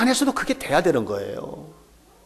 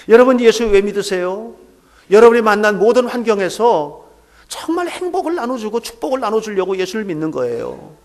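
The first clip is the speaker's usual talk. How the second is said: higher, louder, urgent call to the congregation; full-force preaching; 7 semitones higher, 5 dB louder than usual. Acted. Climax of sermon, calling out. (힘차게 외치는 설교)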